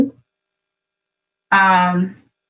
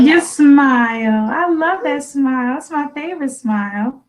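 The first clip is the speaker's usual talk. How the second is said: adoring gush; 5 semitones higher than usual. Real, not acted. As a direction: coo fondly over your little one. Mother gushes over child's smile.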